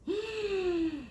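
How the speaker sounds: sharp high gasp; soft gasp. Acted. Reader gasping at the copying. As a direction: gasp in surprise.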